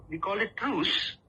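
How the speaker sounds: ordinary delivery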